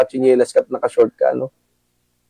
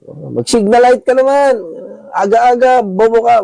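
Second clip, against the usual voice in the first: retold yelling; 11 semitones higher than usual. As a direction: yell at the rider as if he's stupid. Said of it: "Sinigawan ako".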